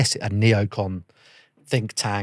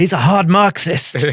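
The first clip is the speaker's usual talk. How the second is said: mock army officer; 8 semitones higher, 8 dB louder, slower than usual. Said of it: "I'm not gonna do the accent".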